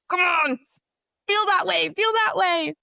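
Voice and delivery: high, loud, strained plea; urging herself. Retold or imitated. Student trying really hard to love him, loud urging. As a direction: force it out as an insistent mock yell.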